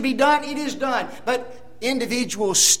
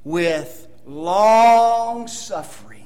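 drawn out, "long" dwelt on; слово "long" протяжно, с ударением; slow stress on long; 4 dB louder, much slower than usual. Shown as a demonstration. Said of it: "the next word is what?".

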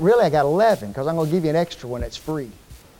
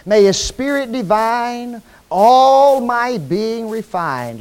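hymn reading; 4 dB louder than usual. Acted. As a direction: read the hymn like a prayer. Reads the hymn stanzas.

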